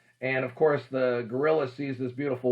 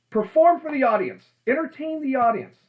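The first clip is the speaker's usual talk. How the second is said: stage projection; 11 semitones higher, 5 dB louder than usual. Shown as a demonstration. Demonstrating big stage performing.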